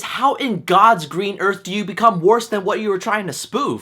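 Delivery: ordinary delivery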